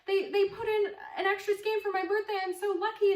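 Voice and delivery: high, loud, fast gushing; freaking out. Retold or imitated. Texting her boyfriend about the gift, high excited gushing. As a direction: squeal it out in a rush. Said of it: "like, freaking out".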